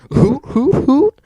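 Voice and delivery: high, loud villain hoots; cartoon "hoo hoo hoo" laugh; hooting laughter. A narrator's reading. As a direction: hoot with laughter like an owl. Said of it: "hooted with laughter".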